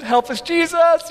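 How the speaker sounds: high-pitched comic plea for help; mock alarm. Dad below the eighth step as son leaps, mock panicked. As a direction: plead as if disaster is about to land on you. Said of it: "I'm like".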